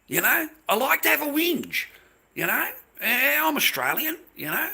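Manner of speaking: mock whiny whinger. Mocking himself as a whinger.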